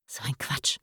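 hushed dismissive whisper; private thought. A narrator's reading. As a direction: whisper it under your breath as a thought. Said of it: "dachte Marie".

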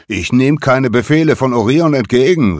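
brash defiant shout; defiant call. A narrator's reading. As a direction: shout back your refusal. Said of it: "rief Nolan".

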